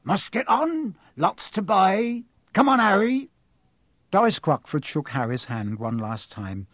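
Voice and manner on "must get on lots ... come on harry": lightly voiced, hearty big man; brisk Hagrid. Hagrid hurrying Harry away, hearty. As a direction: bustle him along.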